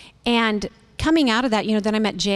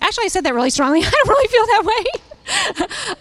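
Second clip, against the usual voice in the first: laughing backpedal; 10 semitones higher, 3 dB louder than usual. Retold or imitated. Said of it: "I've found myself saying this".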